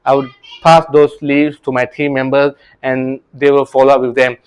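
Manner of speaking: normal talking voice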